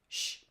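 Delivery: soft hushing sh; sh sound. Shown as a demonstration. Teacher showing children the sh sound. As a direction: breathe out one quiet sh. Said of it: "the middle sound sounds like this".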